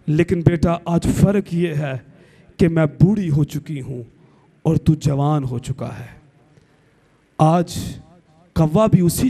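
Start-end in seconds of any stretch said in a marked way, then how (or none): none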